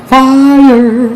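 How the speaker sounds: high, slow drawn-out praise; excited. Real, not acted. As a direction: stretch it out high in delight. Ecstatic over the taste.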